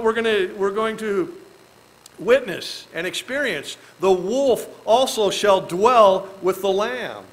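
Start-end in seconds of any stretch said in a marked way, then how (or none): 4.0-7.2 slow, solemn reading of the verse; scripture reading. Acted. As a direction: read the verse slowly and solemnly.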